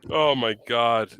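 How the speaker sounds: normal talking voice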